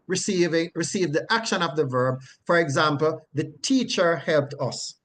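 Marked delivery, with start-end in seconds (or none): none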